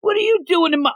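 high-pitched mock indignation; annoyed outburst. Bakugo walks in on Todoroki, mock annoyed. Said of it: "he gets so annoyed".

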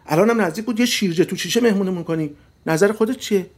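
high, loud rattled-off tirade; angry shouting. A narrator's reading. Wife listing husband's symptoms of rage in the car, angry shouting. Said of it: "داد زد".

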